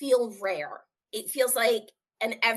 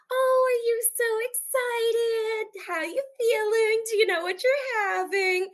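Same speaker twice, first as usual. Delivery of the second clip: overly cheerful nurse; 13 semitones higher than usual. Retold or imitated. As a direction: gush like a bubbly nurse who means well. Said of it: "all happy and cheerful".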